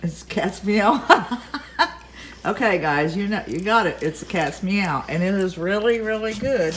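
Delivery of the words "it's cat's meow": higher, drawn out into a laugh; amused echo. Real, not acted. Wife delightedly repeats her taste-tester husband's verdict, laughing. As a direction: repeat it slowly and break up laughing.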